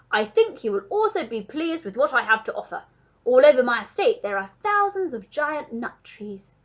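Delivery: loud, generous duke; hearty duke. A narrator's reading. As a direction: proudly offer it in a booming voice.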